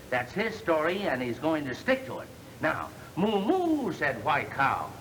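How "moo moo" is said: high mooing cow imitation. Reading the gangsters a story, a hammed-up cow moo. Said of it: "imitations of the animals".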